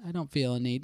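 normal talking voice

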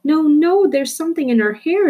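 high, loud alarmed bystander; shout. Acted. A bystander shouts a warning about the hair, high and loud. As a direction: cry out the warning across the room. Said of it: "another person shouted".

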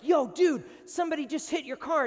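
high, loud, fast alarm; breathless loud prank alarm; panicked friend. Retold or imitated. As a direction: burst in with bad news. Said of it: "jokes with you and they just run in there like".

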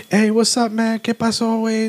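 high, chummy mock greeting; stranger's greeting. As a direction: pitch the stranger's hello way up and friendly. Stranger knocks, voiced greeting.

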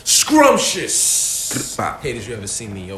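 loud, drawn-out royal proclamation; town crier. Acted. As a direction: announce it like a herald. Podcast intro, hyped proclamation.

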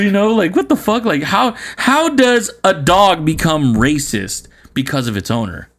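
usual speaking voice

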